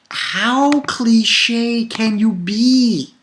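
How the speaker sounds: high, very drawn-out complaint; exasperated. Real, not acted. Reviewer fed up with the cliche villain, exasperated. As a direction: stretch every word in disbelief. Rant after blood boiling.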